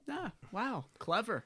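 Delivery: high, soft smirky murmur; smirking, lightly put-on admiration. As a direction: react with an amused little smirk. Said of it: "I think he was smirking".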